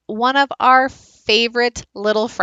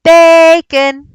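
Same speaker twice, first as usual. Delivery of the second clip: loud, high holler, "ba" stretched long; high sing-out call, dropping on the last syllable; calling the dog; 7 semitones higher, 12 dB louder than usual. Shown as a demonstration. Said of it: "So if his name was Bacon".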